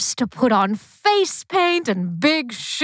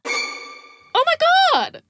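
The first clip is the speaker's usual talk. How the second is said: much higher, stretched horrified cry; horrified shriek; 10 semitones higher than usual. Acted. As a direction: shriek in horror at the screen. Horrified at clown movie.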